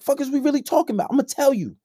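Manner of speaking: ordinary talk, nothing marked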